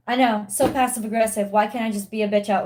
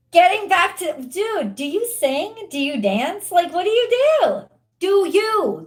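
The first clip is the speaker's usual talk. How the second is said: fired-up urging; 8 semitones higher, 4 dB louder than usual. Real, not acted. Urging the viewer to create.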